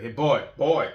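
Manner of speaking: put-on loud call across the yard, boy boy; dad calling his boys. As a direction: summon the kids with a loud call. Calling kids over.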